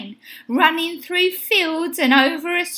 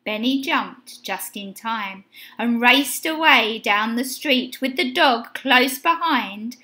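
ordinary delivery

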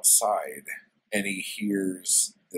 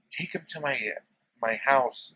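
woman's voice; 6 semitones higher than usual. Retold or imitated. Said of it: "she's like".

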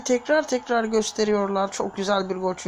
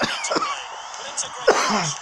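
chesty cough. Real, not acted. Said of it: "öksürüyorum".

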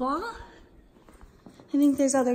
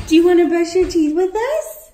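calling the dog; 3 semitones higher, 7 dB louder than usual. Real, not acted. Asks the dog loudly.

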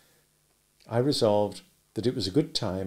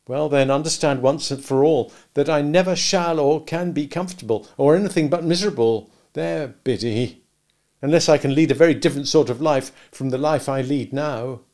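louder and higher, miserable and insistent; frustrated outburst; 4 semitones higher, 7 dB louder than usual. A narrator's reading. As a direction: push it out louder, dragging out there Biddy. Pip voiced, louder.

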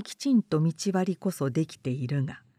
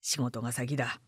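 quiet; 8 semitones lower, 8 dB quieter than usual. Acted. Character mutter.

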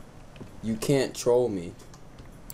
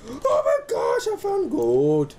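much higher, louder, slow squeal over the gold; excited; 8 semitones higher, 4 dB louder, much slower than usual. Real, not acted. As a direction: gasp with delight at the find. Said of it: "you get excited over a lot of ores".

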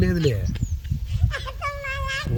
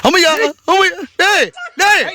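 high pained cry; 12 semitones higher than usual. Acted. Uncle cries out in pain.

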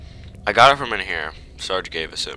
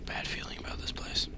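unvoiced, uneasy whisper; hushed and afraid; scared whisper; 7 semitones lower, 16 dB quieter than usual. Real, not acted.

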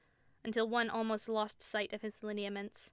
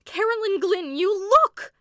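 frightened cry; 11 semitones higher, 14 dB louder, slower than usual. A narrator's reading. Sister terrified by the shadow.

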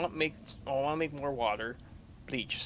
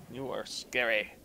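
ordinary talk, nothing marked

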